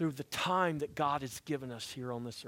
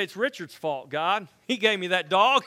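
mock-blaming, louder, breaking into a laugh; laughing mock excuse; 4 semitones higher, 7 dB louder than usual. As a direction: play the guilty dog owner, laughing. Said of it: "I say".

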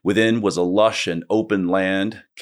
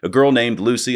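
usual speaking voice

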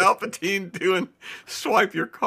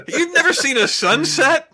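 much higher, louder, laughing disbelief; mock incredulous; 11 semitones higher, 7 dB louder than usual. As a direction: act stunned someone missed a sunset. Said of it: "it's like he's asked".

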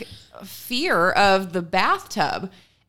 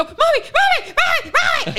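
high, loud imitation of panicked shrieking; hysterical toddler; 5 dB louder than usual. Toddler fearing the bathtub will overflow, hysterical high cries. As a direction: shriek for mom like a frantic child. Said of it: "hysterically, she's having a panic attack".

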